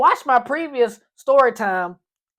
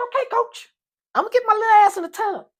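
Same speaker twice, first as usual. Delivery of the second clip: much higher, quick nervous reply; meek kid voice; 11 semitones higher, faster than usual. Retold or imitated. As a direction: answer the coach like a scared kid. Scared kid answering coach.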